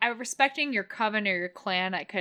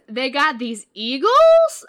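drawn-out, loud questioning, higher pitch; baffled note; 4 semitones higher, 10 dB louder, slower than usual. Retold or imitated. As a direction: read it out loud and slow in puzzled disbelief. Reading own baffled note aloud.

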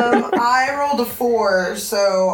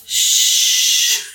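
shushing; 3 dB louder than usual. Acted. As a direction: hush him like a scared animal. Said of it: "I just go".

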